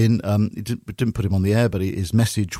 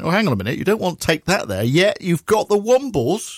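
higher, mock-incredulous challenge; retold indignant protest; 7 semitones higher than usual. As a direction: object in mock disbelief, pitch climbing.

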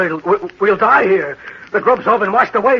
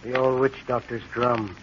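low, hushed, brooding dread; haunted dread; 6 semitones lower, 9 dB quieter than usual. Acted. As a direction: sink into a soft, fearful murmur. Haunted man fixates on the drum.

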